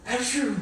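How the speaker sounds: big sharp sneeze; loud fake sneeze. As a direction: belt out a sneeze. Sneeze acted out.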